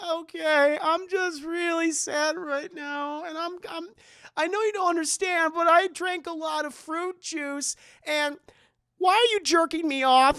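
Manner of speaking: high, mock-sobbing drunk whine; imitated weepy dad.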